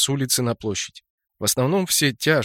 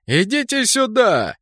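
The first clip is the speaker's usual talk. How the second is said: high, slow drawn-out call; cheerful shout; 8 semitones higher, slower than usual. A narrator's reading. Enemy gnome inviting the squad to fight, cheerful shout. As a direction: beckon them over, stretching the call. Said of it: "весело крикнул один из быков".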